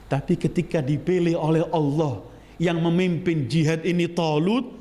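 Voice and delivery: usual speaking voice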